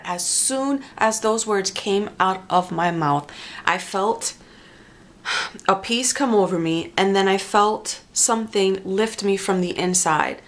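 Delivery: ordinary talk, nothing marked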